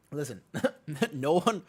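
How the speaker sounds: laughing. Real, not acted. Streamer trying to explain to viewers, giggling. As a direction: try to talk through a laughing fit.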